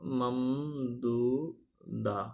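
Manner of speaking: ممدودہ said very slowly syllable by syllable; slow dictation. Shown as a demonstration. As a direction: draw the word out while writing it on the board. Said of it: "لکھ دیتا ہوں میں آپ کی آسانی کے لیے".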